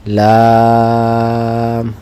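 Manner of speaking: লাম with a very long, level vowel; drawn-out letter name. Shown as a demonstration. Teacher models the letter name for students, drawn out. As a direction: stretch the vowel of লাম and close on m. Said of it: "আমরা একটু টেনে বলি".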